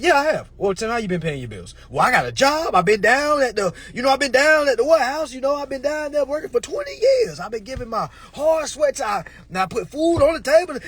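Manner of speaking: high, loud hardworking everyman; defensive worker Tim. Acted. Tim answering the court, high and defensive. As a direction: plead like a proud worker. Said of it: "And then Tim said".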